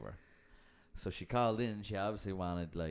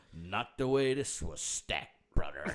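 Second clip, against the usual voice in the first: imitating the woman caller; 3 semitones lower, slower than usual. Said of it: "a fifty-year-old woman say that to you".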